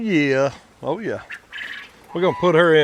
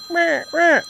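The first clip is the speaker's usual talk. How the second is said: high squawked rah calls; quick mock crow of a male quail; imitated male quail call; 11 semitones higher than usual. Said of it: "instead of rah".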